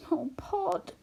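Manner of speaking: breathless, tearful whisper of his name. A narrator's reading. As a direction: whisper it through tears. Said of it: "her eyes full of tears".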